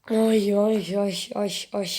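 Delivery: ordinary talk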